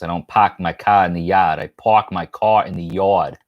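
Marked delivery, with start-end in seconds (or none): 0.3-1.6 mock Massachusetts accent, dropping the R's. Shown as a demonstration. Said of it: "I'm not from Massachusetts". 1.6-3.4 New York accent. Shown as a demonstration. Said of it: "I'm from New York".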